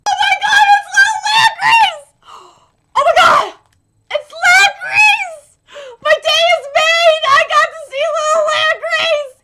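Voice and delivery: high, loud screams of delight; overjoyed screaming. Real, not acted. Fan getting to see Little Langreese, overjoyed screaming. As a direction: scream it as a wish come true.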